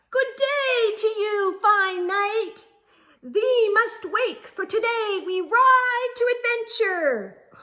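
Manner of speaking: put-on high, loud, grand old-time knight voice; mock knight speech. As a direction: declaim like a pompous little knight. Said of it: "Owls don't talk like that".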